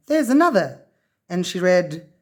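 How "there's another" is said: higher, eager discovery; excited. A narrator's reading. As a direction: point it out with glee. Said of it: "cried Lavernia".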